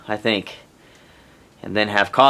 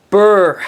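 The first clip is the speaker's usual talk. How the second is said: shivering brr; 6 semitones higher, 7 dB louder than usual. Real, not acted. Cold in the snowy shelter.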